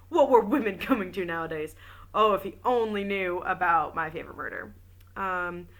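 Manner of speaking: ordinary delivery, nothing marked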